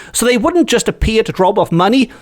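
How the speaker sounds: ordinary delivery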